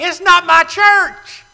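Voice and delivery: NOT hit high and loud; emphatic not. Real, not acted. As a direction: drive home the word not. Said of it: "wanna be emphatically understood".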